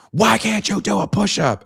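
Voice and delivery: high, loud mock guard yell. Guard mocks the doughy kid, high and loud.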